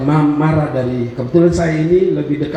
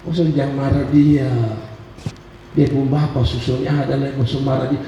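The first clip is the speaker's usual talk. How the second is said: mock-soothing mother, pitched down and hushed; teasing mother; 4 semitones lower than usual. Mother teases her upset youngest child, low mock-soothing voice. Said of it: "mama saya selalu bilang begini".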